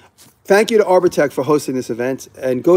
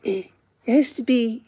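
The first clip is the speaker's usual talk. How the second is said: high, whiny mock objection; mimicked naysayer, pitched way up; mocking YouTube executives; 10 semitones higher, 3 dB quieter than usual. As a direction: put on a high, pinched voice for nitpicking executives. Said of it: "and they're like".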